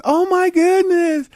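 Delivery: high, slow delighted gasp; thrilled gasp. Retold or imitated. Newcomer thrilled by the anchor chair, high and slow. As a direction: gasp like you just won.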